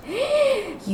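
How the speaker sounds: high, audible gasp of surprise; startled gasp. Acted. Girl seeing the red orange, startled gasp. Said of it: "هین متعجب و بلندی کشید".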